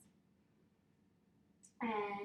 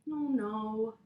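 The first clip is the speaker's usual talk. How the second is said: ordinary delivery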